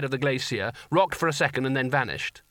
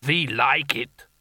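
rumbling giant; 3 dB louder, much slower than usual. A narrator's reading. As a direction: rumble it like a huge ice giant. Giant figure.

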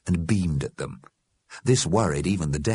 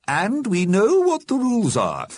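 high, louder, emphatic voice; reproving Missus Liberty; 4 dB louder than usual. A narrator's reading. Missus Liberty reminds the Alderman of the rules, reproving. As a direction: press the rules home firmly. Old lady insists on rules.

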